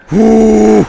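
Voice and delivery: high, loud whoo; loud whoop. Real, not acted. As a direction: whoop with joy. Whooping on a big jump.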